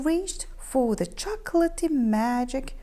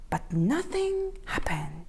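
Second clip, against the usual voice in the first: ordinary delivery, nothing marked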